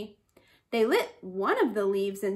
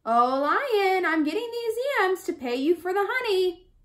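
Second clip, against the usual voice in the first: higher, fast eager call; 6 semitones higher than usual. A narrator's reading. Bush rat calls to the lion from his garden, eager. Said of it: "He called".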